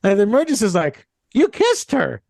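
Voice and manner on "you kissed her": higher, a touch of outrage; shocked accusation. Retold or imitated. Demergis accusing his friend of kissing his date, shocked. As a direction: blurt it like you can't believe it.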